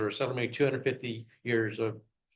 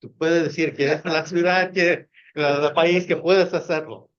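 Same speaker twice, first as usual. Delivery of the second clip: spoken in Spanish, louder and rousing; 4 semitones higher, 6 dB louder than usual. Pretend. Speaker urging the audience in Spanish, louder and rousing. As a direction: switch into native Spanish with fire. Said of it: "in my Spanish accent".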